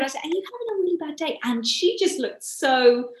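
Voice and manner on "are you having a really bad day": soft, higher, quick caring question; gentle concern, voiced higher and softer; compassionate. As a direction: re-enact asking with empathy. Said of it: "as much compassion as empathy as I could".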